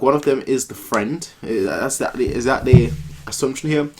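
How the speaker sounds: ordinary talk, nothing marked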